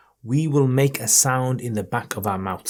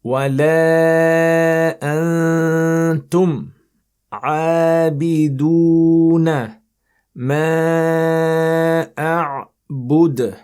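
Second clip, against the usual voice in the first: slow, loud, melodic recitation, higher; recite with the tone; 6 semitones higher, 6 dB louder, much slower than usual. Shown as a demonstration. Teacher shows the melodic tone, tajweed chant. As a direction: lift the verse in a sung tajweed tone. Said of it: "pronounce this with the tone".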